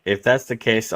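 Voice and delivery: normal talking voice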